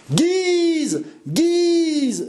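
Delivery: high, loud cheer of a mob; crowd vociferating. A narrator's reading. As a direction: hail the leader with long, high shouts. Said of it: "vociférait le peuple". (acclamation vociférée par le peuple)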